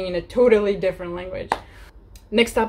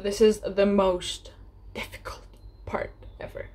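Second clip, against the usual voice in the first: dramatic hush; 4 dB quieter than usual. Acted. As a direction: drop to a dramatic whisper. Dreaded step announced in hushed voice.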